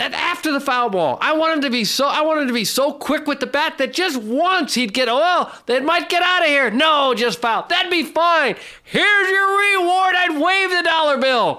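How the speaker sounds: high, impassioned pleading; exasperated rant. Real, not acted. Father explaining the dollar-bill reward to critics, exasperated rant. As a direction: keep the pitch way up as you insist. Rant resumes about foul ball.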